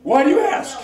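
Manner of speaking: high, loud scolding shout; angry shouting cowboy. Acted. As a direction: yell it at him, fed up.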